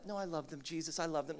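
soft, low, hushed self-correction; mock pious correction. Preacher mocking a jealous believer, hushed fake piety. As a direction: hastily take it back, softly.

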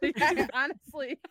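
slow, laughing through words; giggling agreement. Real, not acted. Laughing at tooth fairy joke.

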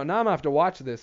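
ordinary delivery

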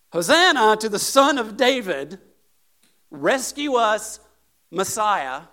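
loud, high cry of praise; shouted acclamation. Acted. Children to Jesus in the temple, shouting. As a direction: cry it out to the whole temple, slowly. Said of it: "started shouting in the temple".

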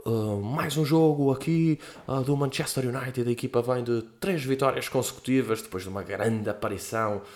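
slow, soft mock match commentary; hushed play-by-play to yourself; football commentary. Shown as a demonstration. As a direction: murmur it like a lone kid commentating FIFA. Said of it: "um gajo ia relatando as merdas".

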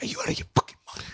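rapid whispered telling-off; mock whispered scolding. Dad to grown child at funeral, mock whispered scolding. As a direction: imitate a dad scolding fast under his breath.

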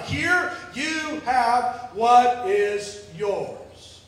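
very slow "Here you have what is yours"; slow, drawn-out. Acted. Servant returning the buried talent, very slow. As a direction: stretch it out.

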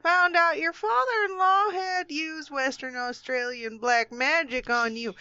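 badly faked Australian accent, drifting toward Kentucky; hammed-up Australian with a Kentucky twang. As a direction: attempt an Aussie accent and miss. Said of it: "that's the worst Australian accent I've ever heard".

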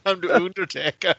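playful fake German accent. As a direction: say it like a hammy German wrestler. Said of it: "he does the whole thing with a German accent".

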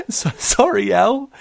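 high apology through giggles; giggling apology. Acted. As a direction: apologise while stifling laughter. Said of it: "tried to contain her laughter".

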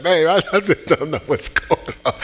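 a retold call, voice high and broken by laughter; laughing and bewildered. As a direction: retell calling your wife while laughing helplessly. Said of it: "I said".

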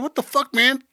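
high, incredulous what-the-fuck; retold disbelief. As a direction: act out the shock of seeing them all. Retold shock at many speakers.

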